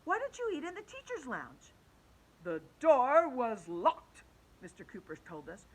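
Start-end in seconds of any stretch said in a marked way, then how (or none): 0.0-1.7 higher, cheeky schoolgirl asking; pushy kid. Acted. Alexia questions the teacher. 2.4-4.2 slow, louder teacher voice; teacher voice explaining to the kids, slow; teacher's excuse. Acted. Teacher Mister Cooper excuses himself.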